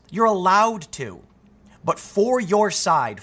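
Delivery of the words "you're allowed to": "you're allowed to" raised and drawn out; stressed for emphasis. Real, not acted. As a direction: press slowly on "you're allowed to", pitched higher. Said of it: "let me stress".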